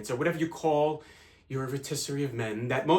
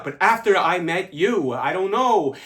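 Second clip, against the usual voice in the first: higher, louder, swelling with feeling; impassioned confession; 4 semitones higher, 7 dB louder than usual. Acted. Telling a lover what meeting them changed, impassioned. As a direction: let the feeling lift the voice.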